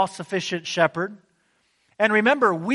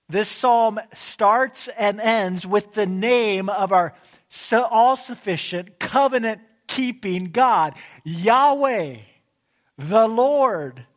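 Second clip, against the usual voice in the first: louder, emphatic preaching from the pulpit; 2 semitones higher, 3 dB louder than usual. Acted. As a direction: drive the point home to the congregation. Preacher builds to emphatic point.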